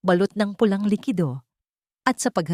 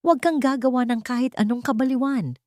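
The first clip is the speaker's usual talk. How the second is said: mild shout; 7 semitones higher than usual. A narrator's reading. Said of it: "sigaw ni Marie".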